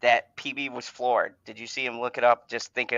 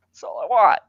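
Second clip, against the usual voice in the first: raised, higher exasperated voice; plead, fed up; exasperated plea; 6 semitones higher, 5 dB louder than usual. Real, not acted. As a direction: voice rising in exasperation. Pleading repeat, fed up with author.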